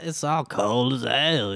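slow, drawn-out mock voice; lightly hammed-up caricature, stretched out slowly; put-on caricature voice. Co-host trying out the fun voice, slow caricature. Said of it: "say in that voice".

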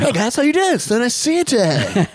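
very high, loud parody greeting; mock their bad impression in a squeaky-high voice; mocking imitation. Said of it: "sounds like Andy Samberg doing Jimmy Fallon".

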